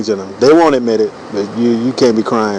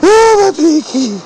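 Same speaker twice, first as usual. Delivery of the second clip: whiny guy with a cut-off pinky; much slower than usual. Retold or imitated. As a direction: shriek like a wimp who lost a finger. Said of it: "you're like".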